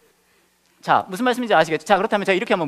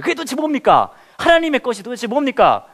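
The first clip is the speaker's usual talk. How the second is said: 목소리를 높여 간절하게 묻기; 답답한 제자처럼 높고 크게 따져 묻기; 다급한 질문; 8 semitones higher, 6 dB louder than usual. Retold or imitated. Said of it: "라고 얘기했을 때". (높고 큰 목소리로 다급하게 묻는 질문)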